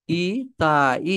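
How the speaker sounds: "ইতাই" drawn out very slowly, syllable by syllable; "ইতাই" in slow, separated syllables; sounded out. Shown as a demonstration. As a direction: stretch each vowel of "ইতাই" for learners. Teacher sounds out new word.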